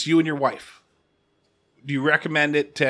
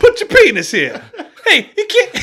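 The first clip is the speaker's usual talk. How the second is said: loud, high mock Boston yell; hammed-up Boston accent; 10 dB louder than usual. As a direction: holler like a Boston local, laughing. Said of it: "You have to drop R's".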